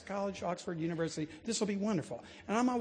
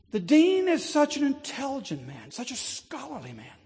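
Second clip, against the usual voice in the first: put-on much higher, gushing English accent; proud English steward; 10 semitones higher, 6 dB louder than usual. Steward boasts the dean is too scholarly, English accent. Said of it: "He didn't say it with my accent".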